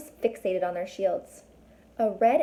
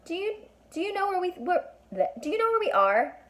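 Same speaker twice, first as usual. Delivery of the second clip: flustered stammer; 11 semitones higher than usual. Acted.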